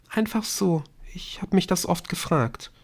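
put-on high, tentative girl's voice; small, high-pitched reply; timid girl. A narrator's reading. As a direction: explain shyly in a high register. Girl timidly explains her question.

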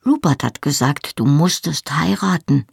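ordinary delivery, nothing marked

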